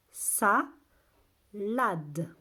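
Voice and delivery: two syllables set well apart. Shown as a demonstration. Teacher shows where A is heard, syllable by syllable. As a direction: break the word in two with a gap.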